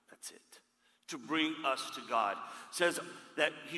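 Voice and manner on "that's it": breathy aside whisper. Real, not acted. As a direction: drop to a whisper to land it. Preacher hushes a key point.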